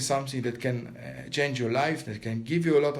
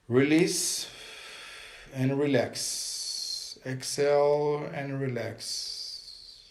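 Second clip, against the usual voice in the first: guided meditation. Acted. Teacher guiding viewers through a breathing meditation, calm and steady. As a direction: coach them through each breath and hold, pressing on hold it.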